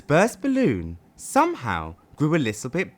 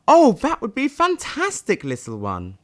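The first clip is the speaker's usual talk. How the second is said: high, louder, delighted dragon voice; smiling dragon; 9 semitones higher, 3 dB louder than usual. Acted. Dragon thanks Sad for counting her eggs, high and delighted. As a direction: gush with grateful delight. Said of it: "The mystical dragon smiled at Sad".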